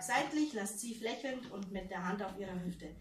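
ordinary delivery

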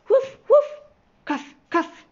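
high, loud engine puffs; imitated train chuffing. A narrator's reading. Train puffs away with passengers, high loud chuffing.

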